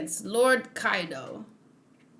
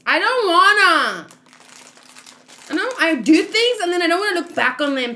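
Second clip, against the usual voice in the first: loud, higher, playful protest; whiny refusal; 6 semitones higher, 5 dB louder than usual. Real, not acted. Asked to look at her attempts, a loud whiny refusal.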